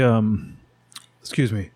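throat catching after a drink; strained, clearing the throat; choking on a drink. Real, not acted. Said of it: "went down the wrong pipe".

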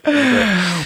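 a high mock echo of That'd be great. Shown as a demonstration. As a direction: squeak it out as a joke.

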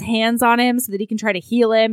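ordinary delivery

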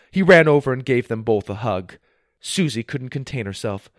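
ordinary delivery

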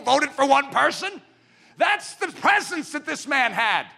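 high and loud with wonder; excited telling. Real, not acted. Preacher marvelling at Washington's unanimous election, high and loud. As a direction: tell it amazed and big.

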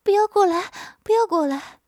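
wife plays the frightened victim, high and loud; mock frightened cry. A narrator's reading. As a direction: cry out as if cornered. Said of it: "一边躲一边叫着".

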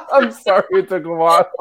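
real laughter breaking through the words; laughing apology. Real, not acted. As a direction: apologize while still laughing, high and loud. Laughing after her horn imitation.

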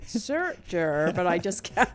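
"Searcher" with a hard American r; attempted American accent. Shown as a demonstration. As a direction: try "Searcher" the American way, straining. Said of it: "I have to try and go".

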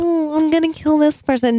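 mock-parrots a Sand Snake's threat in a high put-on voice. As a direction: sneer their threats back at them. Mocking Sand Snake lines.

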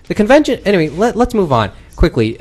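normal talking voice

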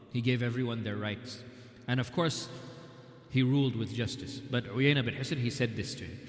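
ordinary talk

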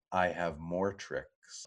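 low, slow, soft flirtation; seductive. Retold or imitated. Chakotay flirting back at Janeway on the bridge, seductively. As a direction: say it slowly and softly, flirting. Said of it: "very seductively and says".

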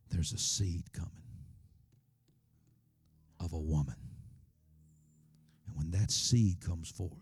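soft, low, slow, sinking to a whisper; hushed prophecy. Acted. God warning the snake, hushed and slow.

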